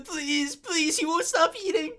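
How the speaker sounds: high-pitched mock begging; high whiny pleading. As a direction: whine it out like a kid.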